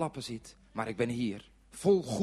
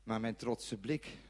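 ordinary delivery

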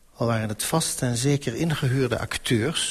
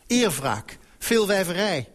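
plain reading voice

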